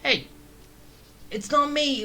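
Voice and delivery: normal talking voice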